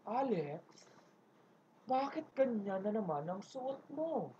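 slow, soft, quietly stern boss voice; lightly voiced stern boss, slow and hushed; calm but angry boss. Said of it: "Malumanay, pero alam mong galit". (mahinahon pero galit na boss)

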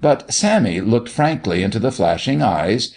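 plain reading voice

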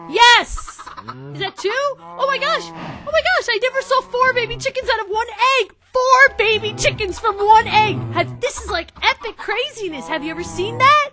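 very high, loud squealing joy; ecstatic shriek. Real, not acted. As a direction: scream with delight at the surprise. Four chicks hatch from one egg.

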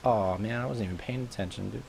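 ordinary talk, nothing marked

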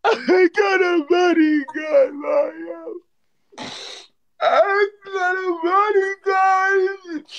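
high, long-drawn celebratory howl; slow, high-pitched hype holler; hyped wail. Real, not acted. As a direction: stretch every word into a high cry. Celebrates a gift on stream.

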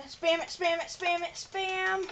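high, eager spam it calls; excited urging. Real, not acted. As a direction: urge him on, keyed up. Urging friend in chase.

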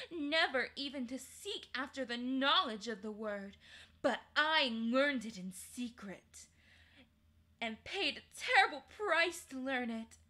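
proud and cruel, rising to pride; ruthless queen. Acted.

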